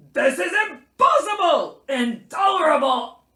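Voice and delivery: high, very loud outraged bear; roaring. A narrator's reading. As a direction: erupt into an exasperated roar. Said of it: "Bear started to roar".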